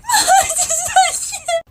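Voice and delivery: put-on loud, high-pitched child wail; hammed-up shrieking sob; mock sobbing. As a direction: bawl like a hysterical five-year-old. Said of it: "I start sobbing".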